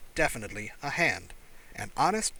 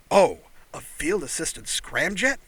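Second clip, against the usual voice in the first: excited; 4 semitones higher, 6 dB louder, slower than usual. Acted. Alien excited about engine.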